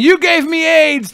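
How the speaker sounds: high, slow dramatic accusation; overacted betrayed lover, drawn out; hammed-up accusing cry; mock accusation. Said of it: "I wanna reenact this scene".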